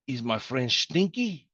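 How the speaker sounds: slow, silly put-on voice asking if his French stinks; mock French. Teacher jokes with students about his bad French, slow and goofy. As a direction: drawl the word stinky in a goofy accent. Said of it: "So I could say to you".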